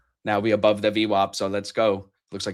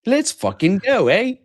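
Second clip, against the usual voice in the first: higher, slow, hammed-up Canadian accent; a botched Canadian cheer that drifts British, drawn out; attempted Canadian accent; 10 semitones higher, 4 dB louder, slower than usual. Co-host echoes a cheer on stream in a mock Canadian accent. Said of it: "that was more British than Canadian".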